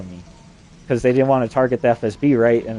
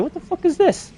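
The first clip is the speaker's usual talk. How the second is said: squeaky high-pitched shock, much higher; shocked outburst. Retold or imitated. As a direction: yelp it out in disbelief. Shocked by what he sees.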